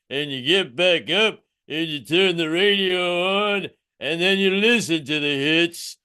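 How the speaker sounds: slow, slurred Philly boxer; Sylvester Stallone. Acted. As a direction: drawl a life lesson like a movie boxer. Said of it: "Mister Stallone always had a lesson".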